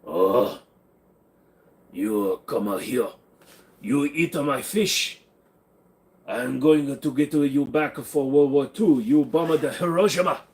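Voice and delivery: exaggerated mock Japanese accent, slowed at the start; joking Japanese accent at chat. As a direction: ham up a Japanese accent for laughs. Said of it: "I can't do a Japanese accent very good".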